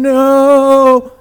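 loud, high hammed-up shriek of a scared rider; mock panicked yell. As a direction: yell it like a wimp hitting the brakes. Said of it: "button going".

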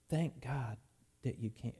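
muttered quietly to herself; under her breath. Retold or imitated. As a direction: say it half-swallowed, under the breath. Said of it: "I heard her under her breath".

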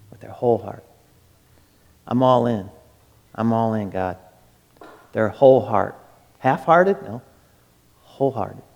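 soft, low, earnest words; quiet intensity. Real, not acted. Preacher softly stressing wholehearted devotion, quiet. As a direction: pull back and say it softly, meaning it.

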